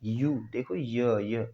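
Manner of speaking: यू and य drawn out very slowly; slow, stretched य sound. Shown as a demonstration. Teacher stretching य to show university's onset. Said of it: "यूनिवर्सिटी".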